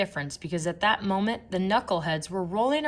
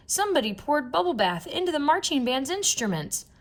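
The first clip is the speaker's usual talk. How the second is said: higher indignant fan voice; excited fan; 9 semitones higher than usual. A narrator's reading. Fan in the bleachers telling everyone, higher excited voice. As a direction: announce the discovery to the stands. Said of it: "shouted a fan".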